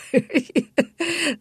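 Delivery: bright high burst of laughter. Real, not acted. Amused laugh mid-answer.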